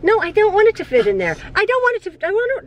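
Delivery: ordinary talk, nothing marked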